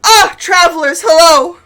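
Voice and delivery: high, loud, flustered greeting; startled high-pitched priest welcoming riders. Acted. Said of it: "He goes".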